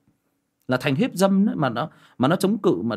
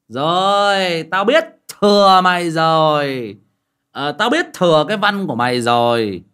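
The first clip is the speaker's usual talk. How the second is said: higher and louder, drawn-out scoffing voice; mock retort; 5 semitones higher, 9 dB louder, slower than usual. Friend scoffing at the wife's tricks, loud mocking voice. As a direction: scoff loudly in an altered voice, stretching biết.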